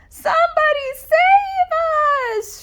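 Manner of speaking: very high, loud, slow shriek; shrieking plea. Acted. Dogs in the pond shrieking for rescue, high shriek. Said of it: "shrieked the dogs".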